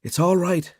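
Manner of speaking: suppressed anger. A narrator's reading. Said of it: "swallowing his anger".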